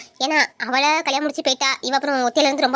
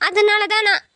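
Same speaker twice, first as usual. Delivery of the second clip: put-on higher-pitched, hurt young girl pouring out her troubles; tearful young niece; 3 semitones higher than usual. Niece confiding her friend troubles to her uncle, higher tearful voice. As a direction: play her as a wounded teen close to tears.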